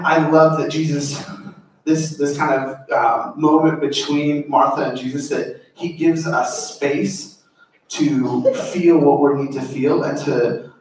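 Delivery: usual speaking voice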